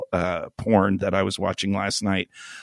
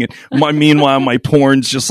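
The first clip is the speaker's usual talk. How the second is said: ordinary talk, nothing marked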